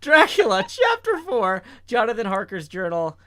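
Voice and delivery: loud, grand title announcement; booming, far too serious narrator; overly serious narrator. Acted. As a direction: announce the chapter with mock gravity. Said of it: "the far too serious voice for the narrator".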